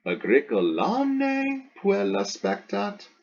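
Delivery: slow question, "Agricolam ne" lifted high; emphasize "Agricolam", voice staying high to the end; stress on AGRICOLAM. Shown as a demonstration. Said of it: "the agricolam".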